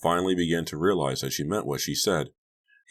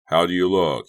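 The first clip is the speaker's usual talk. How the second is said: louder call across the room; 2 semitones higher, 7 dB louder than usual. A narrator's reading. Chuck calls to Donnie at mirror, loud call. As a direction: project it to someone farther off. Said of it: "he called to Donnie".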